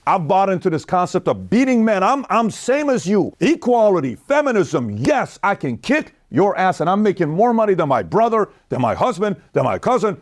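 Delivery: high, mocking boastful woman voice; sneering caricature of a bragging wife; mocking feminist. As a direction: send up a smug career woman.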